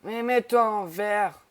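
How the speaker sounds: loud, slow call. Real, not acted. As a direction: raise the voice to reach a friend. Calling to friend. (appel fort et lent)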